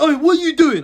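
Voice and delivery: teacher shouting at a screaming pupil; teacher yelling. Retold or imitated. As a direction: snap at the kid like a startled teacher. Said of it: "The teacher's like".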